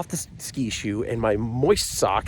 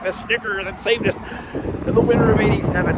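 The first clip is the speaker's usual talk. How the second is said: higher, solemn rat elder recounting legend; a touch of a squeaky rat elder, faster at the end; reverent rat voice; 6 semitones higher than usual. Pretend. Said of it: "that they're like".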